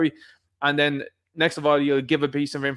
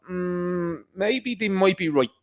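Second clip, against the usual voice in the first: musing; 5 semitones higher, much slower than usual. Retold or imitated.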